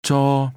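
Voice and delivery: "Cho" held on a level mid tone. Shown as a demonstration. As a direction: say "Cho" evenly, with neither rise nor fall. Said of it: "Notice the mid-level tone".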